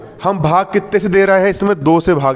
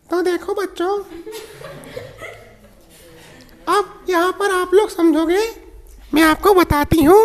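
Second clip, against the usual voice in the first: man doing a woman's voice; 13 semitones higher than usual. Retold or imitated. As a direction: play the madam teaching kids. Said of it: "अब madam के बारे में पढ़ा के दिखाऊं".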